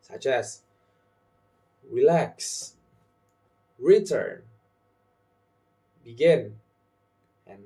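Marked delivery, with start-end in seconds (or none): 1.9-6.6 "relax", "return", "begin" as re-LAX, re-TURN, be-GIN; stress on second syllable. Shown as a demonstration. Teacher giving example verbs, second syllables stressed. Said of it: "You must stress the second syllable".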